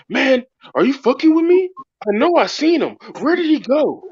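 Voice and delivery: high-pitched, agitated disbelief; tripping-out cop. Retold or imitated. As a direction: imitate a cop losing his grip. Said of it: "this dude is, like, tripping out".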